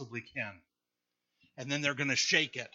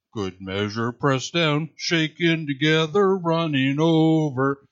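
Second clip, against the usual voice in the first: [name]; 11 dB louder, slower than usual. Retold or imitated. Preacher imitating a singer for the congregation, booming bass voice. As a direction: boom it out as a gospel group's bass singer. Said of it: "his amazingly bass voice".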